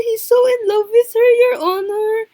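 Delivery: high, loud gushing squeal; gushing fangirl. Real, not acted. Player gushing to chat about the lovers, loud high squeal. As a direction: gush over the couple, barely contained.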